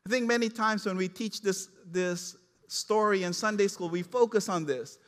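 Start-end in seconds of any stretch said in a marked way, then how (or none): none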